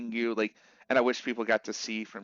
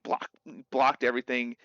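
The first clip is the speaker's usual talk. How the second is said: ordinary talk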